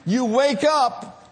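jokey preacher build-up; preaching. Acted. Pastor joking to the congregation, lively preaching. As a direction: play the tough-day list for laughs.

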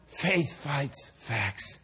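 very slow, low and soft; slow, measured statement. Real, not acted. Preacher to congregation after birth story, slow measured statement. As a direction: let each word land quietly. Sermon point stated first time.

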